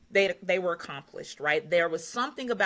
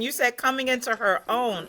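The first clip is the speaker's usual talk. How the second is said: normal talking voice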